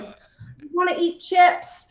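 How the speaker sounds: put-on high, slow, whiny little-kid plea; whiny self-quote. Begging her fit in-laws for snacks, whiny. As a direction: whine it like a tired child. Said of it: "And I'm always like".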